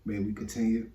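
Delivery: soft low prayer voice. Acted. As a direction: pray it quietly. Saying grace before food.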